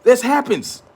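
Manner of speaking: high, insistent This happens; emphatic. Real, not acted. Repeats the phrase, first emphatic.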